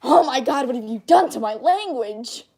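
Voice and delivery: loud, appalled native speaker; hammed-up shocked outburst; mock outraged French speaker. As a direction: cry out like someone hearing their language mangled. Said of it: "any French speaker hears me try and speak French".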